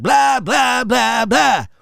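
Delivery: high-pitched mocking blah-blah; mocking announcers. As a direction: spit out exaggerated blahs, each one leaned on. Mimicking announcers droning on, dismissively.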